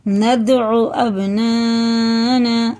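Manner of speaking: very slowly recited नदउ अबनाना with bouncing stops; slow qalqala recitation; qalqala demo. Shown as a demonstration. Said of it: "कलकला है".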